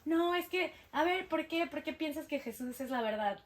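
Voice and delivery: mildly combative objection; argumentative challenge. Retold or imitated. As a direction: challenge them as in a retold squabble. Acting out arguments with relatives.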